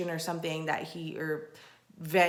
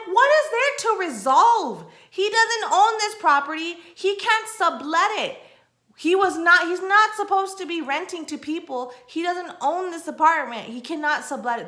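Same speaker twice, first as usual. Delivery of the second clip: shouting high and loud at the support agent; retelling a furious phone rant, loud and pitched way up; angry yelling; 9 semitones higher, 6 dB louder than usual. Retold or imitated. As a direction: let them have it, outraged and loud. Angry rant retold to Airbnb.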